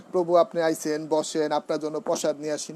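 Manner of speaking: casual regional Bengali, আইছেন and বসেন said loosely; colloquial dialect greeting. Shown as a demonstration. Devotee welcoming a guest, wrong casual way: loose dialect. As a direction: greet the devotee in everyday village speech.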